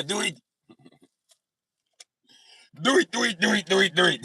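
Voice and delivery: put-on exasperated, high-pitched Austrian-accented outburst; hammed-up Austrian accent, rapid-fire commands; Arnold Schwarzenegger accent. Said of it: "doing an Arnold Schwarzenegger accent".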